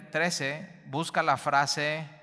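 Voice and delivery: usual speaking voice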